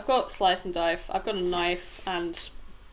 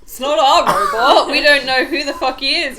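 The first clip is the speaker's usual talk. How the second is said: loud laughing protest; 7 semitones higher, 12 dB louder than usual. Real, not acted.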